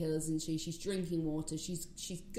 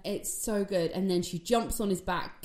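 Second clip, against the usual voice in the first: ordinary talk, nothing marked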